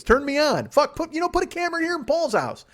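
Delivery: high, loud excited outburst; excited demand. Pretend. Host pitching himself as the TV star, excited. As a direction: shout it, carried away with the idea.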